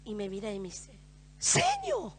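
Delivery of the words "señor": put-on tearful child.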